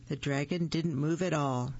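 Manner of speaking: plain reading voice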